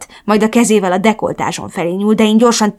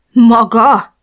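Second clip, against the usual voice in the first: startled question, higher and louder; shocked; 4 semitones higher, 7 dB louder than usual. A narrator's reading. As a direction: blurt it out, taken aback. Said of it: "rökönyödtem meg". (megrökönyödve)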